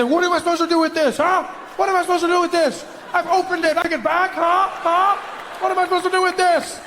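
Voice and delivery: high exasperated shouting; hammed-up indignant yelling; mock outrage. Comedian mocking a man complaining to his wife. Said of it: "And I say".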